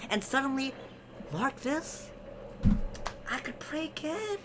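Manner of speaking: hammed-up tale, ending squeakily high; rising theatrical voice. Husband telling how his prayers returned, hammed-up rising pitch. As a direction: climb steadily into very high falsetto.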